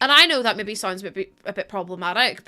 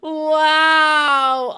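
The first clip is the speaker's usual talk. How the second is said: loud, high howling wow; drawn-out dog-like howl; mock howl; 5 semitones higher, 6 dB louder than usual. Real, not acted.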